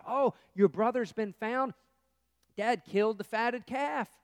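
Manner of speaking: loud, eager servant breaking the news; excited servant. Acted. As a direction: gush the good news to the brother, loud and high.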